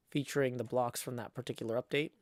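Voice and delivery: ordinary talk, nothing marked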